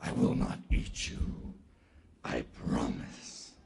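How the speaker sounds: very low, hushed, slow voice; promising leopard. Acted. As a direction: promise softly as a big cat.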